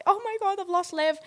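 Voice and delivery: high-pitched mock alarm; mock panic. Boyfriend realises he has lost his girlfriend, mock panic.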